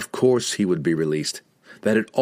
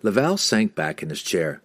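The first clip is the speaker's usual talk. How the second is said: plain reading voice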